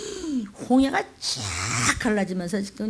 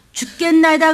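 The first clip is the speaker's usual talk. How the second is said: called-out answer; 5 semitones higher, 8 dB louder than usual. Shown as a demonstration. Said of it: "우리가?".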